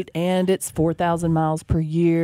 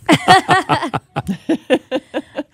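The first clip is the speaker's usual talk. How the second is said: real laugh; 10 semitones higher, 7 dB louder than usual. Real, not acted. Reacting to cheap Prius fill-up.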